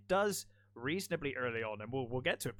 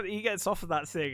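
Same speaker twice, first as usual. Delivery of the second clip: ordinary talk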